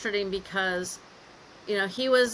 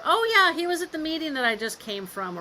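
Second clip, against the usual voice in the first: breezy cover story; 7 semitones higher than usual. Retold or imitated. Daughter covers for dad to mom, breezy pretence.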